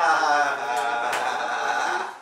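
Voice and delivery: helpless laugh while being tickled; ticklish giggling. Real, not acted. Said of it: "Ayağımı gıdıklıyorlar".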